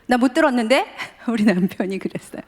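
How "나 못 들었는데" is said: father getting heated. Retold or imitated. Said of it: "점점 서로 격해".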